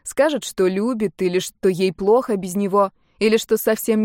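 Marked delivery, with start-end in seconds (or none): none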